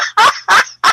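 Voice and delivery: high, loud burst of laughter. Real, not acted. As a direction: crack up out loud.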